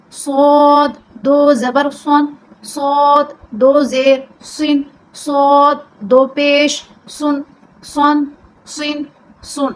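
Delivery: صاد said full and heavy, slow drill; heavy suad. Shown as a demonstration. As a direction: round out the سن heavily. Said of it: "اس کو آپ نے پر کرنا ہے".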